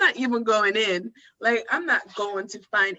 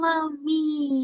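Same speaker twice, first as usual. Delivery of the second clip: put-on creepy ghost girl; 3 semitones higher, slower than usual. Ghost girl luring, acted.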